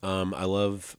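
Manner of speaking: talking while crunching ice; chewing ice. Real, not acted. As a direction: mouth full of ice. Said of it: "I'm chewing ice right in the microphone".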